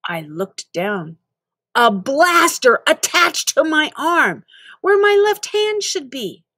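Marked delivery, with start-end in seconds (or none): 1.7-6.4 alarmed disbelief. Acted. Shocked discovery of blaster arm.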